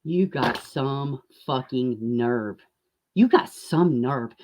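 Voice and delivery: slow, low seething contempt. Real, not acted. Slowly condemning MGL's funeral request, seething. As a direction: spit it out slowly.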